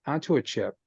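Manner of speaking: ordinary talk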